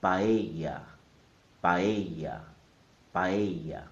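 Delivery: paella as pa-EH-ya, loud on the middle syllable; stress on E. Shown as a demonstration. Teacher saying it three times, paella stressed pa-EH-ya. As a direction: say paella loudly and deliberately, leaning on the e. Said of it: "The stress is on the e sound".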